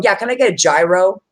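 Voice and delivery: "gyro" said as JAI-roh, rising question; wrong pronunciation. Retold or imitated. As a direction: imitate a customer saying "gyro" with a J. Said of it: "that's not how it's said".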